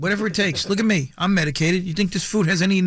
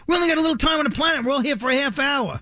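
excited shout; 8 semitones higher than usual. Real, not acted. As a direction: pump up the crowd. Hyping the crowd.

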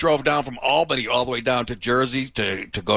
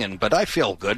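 usual speaking voice

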